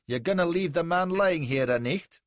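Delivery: loud, high Scots protest; indignant Scottish voice; strong Scottish accent. A narrator's reading. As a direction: demand it in broad, loud Scots. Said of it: "in a strong Scotch accent".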